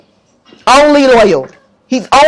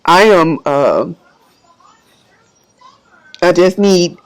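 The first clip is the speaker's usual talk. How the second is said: lower, choked-up and halting; emotional, subdued; 6 semitones lower than usual. Real, not acted. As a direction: voice sinking with emotion, pausing often. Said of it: "I'm gonna get a little emotional".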